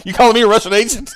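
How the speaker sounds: loud, high mock indignation through laughter; mock outrage. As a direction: blurt it out as an incredulous imitation. Mock-quoting the outraged clip, laughing.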